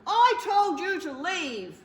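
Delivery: slow, loud scolding from the bear; bear crying out. Acted. Bear scolds the mouse in his cupboard, loud and slow. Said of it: "cried Bear".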